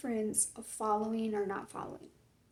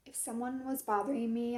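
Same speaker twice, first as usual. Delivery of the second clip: normal talking voice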